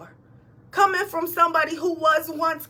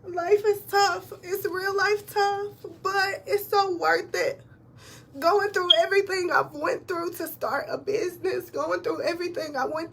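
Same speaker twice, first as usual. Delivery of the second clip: crying; 3 semitones higher than usual. Real, not acted. Telling viewers life is hard, crying. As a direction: let the tears come through the words. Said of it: "I didn't mean to cry".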